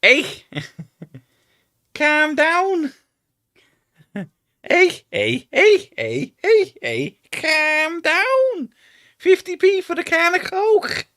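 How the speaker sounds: very high, loud, hammed-up Geordie; a squeaky over-the-top Geordie shop worker; mock Geordie accent. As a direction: shriek it like a cartoon Geordie shopkeeper. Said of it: "one of them goes".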